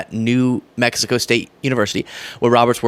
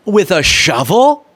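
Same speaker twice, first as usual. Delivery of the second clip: incredulous; 5 semitones higher, 4 dB louder, slower than usual. Real, not acted. Reacting in disbelief.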